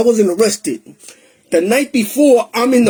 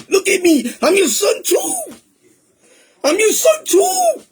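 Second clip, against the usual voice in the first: crying prayer; 8 semitones higher than usual. Retold or imitated. Praying to God after pacing all night, desperate and crying. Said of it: "tired of crying and pacing".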